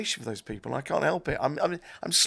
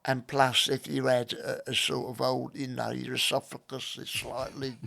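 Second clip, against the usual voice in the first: lightly voiced old Cockney man; a hint of a dry-throated old Cockney. Shown as a demonstration. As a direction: slip into an old Cockney geezer's voice. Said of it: "I could sort of recreate quite faithfully".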